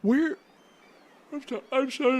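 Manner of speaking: talking through a yawn. Real, not acted. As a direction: yawn mid-sentence. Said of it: "I'm yawning so much".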